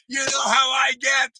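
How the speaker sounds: normal talking voice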